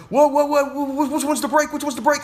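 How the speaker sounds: high, loud mock panic; Michael panicking. Learner driver hunting for the brake, high frantic imitation.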